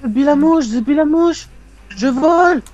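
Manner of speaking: high, loud excited cry. Real, not acted. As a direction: yell it in triumph. (cri excité)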